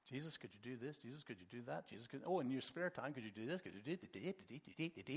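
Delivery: low, soft mumbled rattle; rote checklist prayer. Acted. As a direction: reel off requests by rote, dissolving into dit-dit syllables. Mimics running down prayer checklist.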